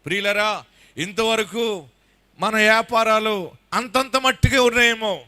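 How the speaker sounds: ordinary talk, nothing marked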